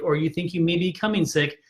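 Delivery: normal talking voice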